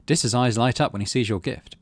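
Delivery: ordinary talk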